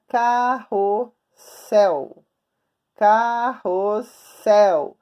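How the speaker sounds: "Carrossel" very slow, split car-ros-SEL; syllable by syllable. Shown as a demonstration. Teacher inviting pupils to try, "Carrossel" slowly sounded out. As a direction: draw out each syllable of "Carrossel" with gaps between. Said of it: "Vamos tentar fazer agora?".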